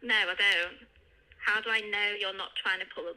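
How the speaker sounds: usual speaking voice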